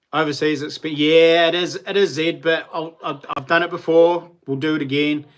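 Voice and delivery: normal talking voice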